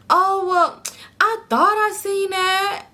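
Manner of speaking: high, slow put-on excuse; mimicked sheepish friend. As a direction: imitate the friend's lame excuse, pitched high.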